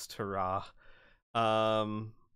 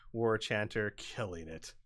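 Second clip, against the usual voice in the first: ordinary delivery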